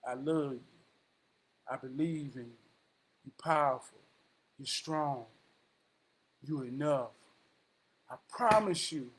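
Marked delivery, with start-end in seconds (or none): 0.0-7.1 affirmation. Shown as a demonstration. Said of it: "and say".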